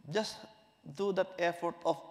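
ordinary talk, nothing marked

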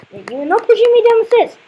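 fast, high, loud outburst; indignant protest. Real, not acted. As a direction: protest loudly in a sudden rush. Being pushed down stairs in game.

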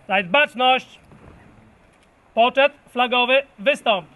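loud barked parade orders; drill sergeant. Real, not acted. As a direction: call the colour party forward. Commander calling the colour party.

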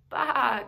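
storyteller's bleat of a lost lamb; crying lamb bleat. Acted. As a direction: cry out like a scared lamb. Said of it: "cried the little lamb".

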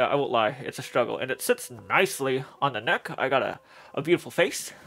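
speaking inside rubber mask; muffled through a mask. Real, not acted. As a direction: voice dampened by headpiece. Seen 2: Full-head alien silicone mask covers the face and mouth throughout.

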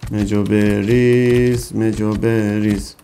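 مجو بریز stretched very slowly, each syllable held; slow drawn-out repeat. Real, not acted. Gamer drawling the command while feeding the dino slowly. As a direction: drag out بریز lazily.

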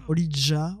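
Olija with a "dja" sound at the end; Olija, ending in "dja", as one possible reading; tentative pronunciation. Shown as a demonstration. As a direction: try saying Olija with a J sound. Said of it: "je sais pas comment ça se prononce".

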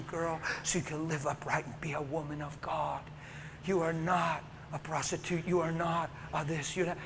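soft sermon tenderness; tender reassurance. Real, not acted. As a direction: speak gently like a pastor to her. Tender pastoral speech.

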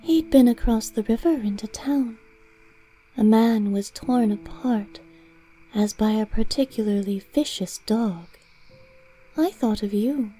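cat Greymalk voice. Acted.

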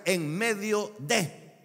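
"en medio de" drawn out, rising on "medio"; slow emphatic definition. Shown as a demonstration. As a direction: spell the phrase out slowly. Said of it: "La preposición en significa".

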